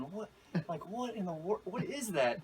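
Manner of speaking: imitated stunned bystander; dumbfounded onlooker. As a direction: act out a baffled double take. Motorist realizes it is an alligator.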